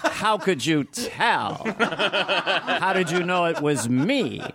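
slow, drawn-out, high-pitched mock astonishment; hammed-up shock at being recognised; Jon Lovitz. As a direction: stretch each word in theatrical disbelief. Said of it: "put two inches of prosthetic makeup on Jon Lovitz".

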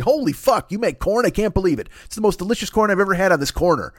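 a hint of a high, hyped TV host; mock celebrity chef amazement; Guy Fieri impression. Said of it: "he's just like".

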